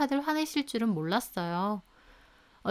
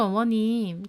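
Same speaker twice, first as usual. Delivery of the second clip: ordinary delivery, nothing marked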